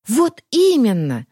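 slow, emphatic, eager; fervent exclamation. A narrator's reading. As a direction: exclaim it with passion. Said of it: "с жаром воскликнула Варя".

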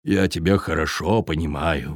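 low, soft sympathetic sigh; low gentle giant sighing; sighing viking. Acted. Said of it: "вздохнул он". (со вздохом, тихо)

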